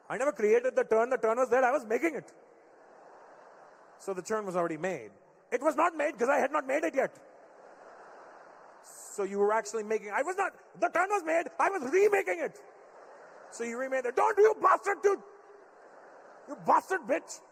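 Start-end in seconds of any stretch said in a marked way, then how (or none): 0.1-12.6 dad defending his turn, getting worked up; heated Indian dad. Retold or imitated. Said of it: "make everything sound Indian". 14.2-17.4 put-on much higher, louder Indian-accented yell; dad cursing at his son, shouting; furious Indian dad.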